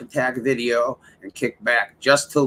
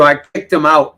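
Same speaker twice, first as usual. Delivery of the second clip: normal talking voice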